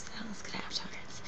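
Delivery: breathy secret whisper of pleasure. Acted. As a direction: whisper it like a guilty delight. Repeats the line as a whisper.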